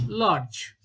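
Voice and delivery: usual speaking voice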